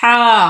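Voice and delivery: ہا pushed from mid-throat like cold air, held; long ہا with throaty friction; mid-throat haa. Shown as a demonstration. Teacher demonstrates after the winter example, mid-throat ہا. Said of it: "درمیانی حلق سے ہم نکالیں گے".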